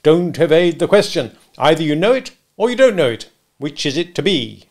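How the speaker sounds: domineering cross-examiner; bullying interrogation. Acted. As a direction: browbeat a witness with each question. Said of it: "in a bullying, interrogative manner".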